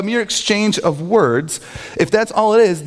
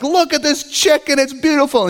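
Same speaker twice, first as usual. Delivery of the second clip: high-pitched showy marvelling; mock delighted admiration; 10 semitones higher than usual. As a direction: act out someone gushing over food. Retold gloating, acted quote.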